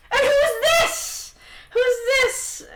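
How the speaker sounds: high, loud, drawn-out teasing question; joking nosiness. Acted. Merlin noticing the stranger beside Cain, loud high teasing. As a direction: make a big show of curiosity. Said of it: "As you can tell, he's joking".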